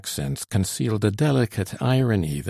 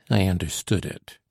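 plain reading voice

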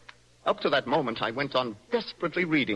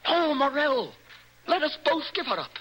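excited plea; 10 semitones higher than usual. Acted. Young poet pleads excitedly.

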